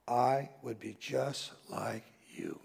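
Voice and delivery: slow, unvoiced whisper. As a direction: confess it in a hushed whisper. Humble sheep confession.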